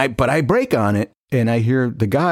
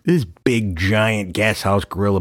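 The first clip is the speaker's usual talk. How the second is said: normal talking voice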